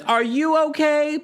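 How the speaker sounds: high, slow, drawn-out tagline delivery; theatrical high-pitched question; sassy tagline. Acted. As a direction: stretch it out like a show-opening tagline. Performs a suggested tagline.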